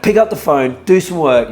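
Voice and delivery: loud, punchy pep talk; retold urging. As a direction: re-enact pushing him to work. Retells urging Jake on.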